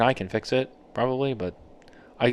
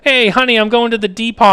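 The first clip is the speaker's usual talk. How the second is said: a higher, louder dad calling to mom; 11 semitones higher, 9 dB louder than usual. Retold or imitated. Dad calls out to mom that he's off to the Depot, in a higher, louder voice. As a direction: play the cheery dad announcing his errand. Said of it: "That's what my dad used to do".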